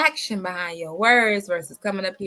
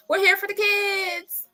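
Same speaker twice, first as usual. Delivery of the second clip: much higher, slow, drawn out here; high slow sing-song; 10 semitones higher than usual. Real, not acted. Repeats catchphrase, slow.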